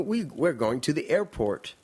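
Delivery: slow, careful American-English learner; American textbook English. Retold or imitated. Japanese girl taught by an American book, careful American accent. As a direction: imitate textbook American English, word by word, slowly. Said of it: "she's learnt English from the American".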